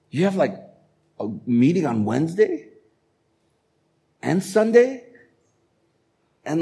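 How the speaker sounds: mock a friend who can't believe it; incredulous friend. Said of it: "you're gonna hear this from your friends".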